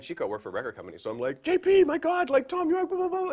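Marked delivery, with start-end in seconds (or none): 1.4-3.3 very high, loud, breathless excitement; excited squeal. Retold or imitated. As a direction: squeal it like a thrilled fan. Retelling his own excited call.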